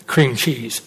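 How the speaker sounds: low, soft, appalled repeat of cream cheese; disgusted echo. Retold or imitated. Preacher echoing mom's dessert offer, low and disgusted. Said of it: "Are you kidding me?".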